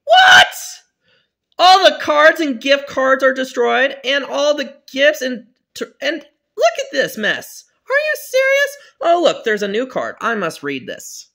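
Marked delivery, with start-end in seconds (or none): 0.0-0.5 high, loud shocked cry; shocked shout. Acted. Dad sees mess. 1.6-10.2 loud, high and dismayed; upset shout. Acted. Dad upset at mess.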